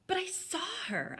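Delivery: high, whispered insistence; insisting. A narrator's reading. Baby Bear insisting to Dad he saw her, high. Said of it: "I insisted".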